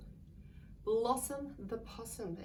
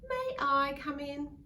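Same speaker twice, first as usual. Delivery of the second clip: louder polite ask; polite kookaburra; 6 semitones higher, 7 dB louder, slower than usual. Acted. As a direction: ask nicely, lightly voiced bird. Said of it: "Kookaburra asked politely".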